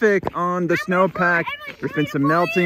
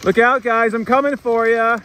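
calling out; 8 semitones higher, 5 dB louder than usual. Real, not acted. Calling out while sledding.

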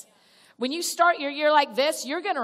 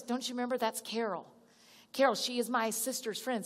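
soft friend's reminder; 7 dB quieter than usual. Retold or imitated. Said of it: "your friend goes".

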